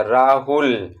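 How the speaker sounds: Rahul slowed, its ending held long; drawn-out calling name. Shown as a demonstration. Teacher shows how one calls Rahul from behind, drawn out. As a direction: stretch Rahul as if calling him. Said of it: "ल थोड़ा सा ज़्यादा समय तक".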